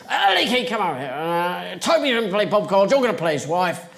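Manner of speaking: brisk director handing out parts; bossy director. Retold or imitated. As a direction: call out high and loud, then issue instructions. Director calling actress over, casting her.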